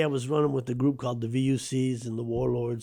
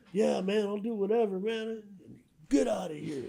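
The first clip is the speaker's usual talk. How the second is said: much higher, hurried agreement; eager scared kid; 8 semitones higher than usual. Retold or imitated. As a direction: promise anything to get free.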